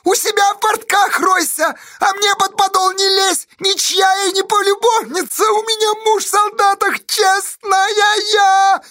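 extremely high, indignant screech; shrieking. A narrator's reading. Fiska defending her honour, shrieking. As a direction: screech it, stretching the last words. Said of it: "завизжала Фиска".

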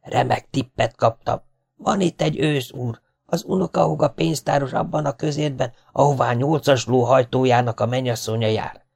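high-pitched, slightly hushed confiding; furtive tipster. Acted. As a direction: pass on a secret tip cautiously. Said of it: "szólt, és óvatosan körülnézett".

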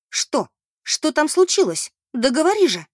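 high, loud anxious demand; alarmed. A narrator's reading. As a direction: press urgently for the news, rising in alarm. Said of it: "Мэри объяла тревога".

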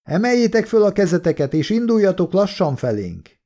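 high-pitched command from afar; shouted back. A narrator's reading. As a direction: call an order across the field. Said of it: "kiáltott vissza valaki".

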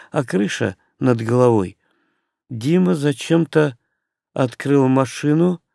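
ordinary delivery

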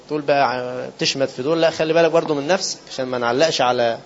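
normal talking voice

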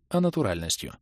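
ordinary delivery

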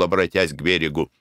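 ordinary delivery, nothing marked

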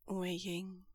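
tender breathed whisper. Acted. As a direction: exhale his name. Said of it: "Lan Zhan breathes out".

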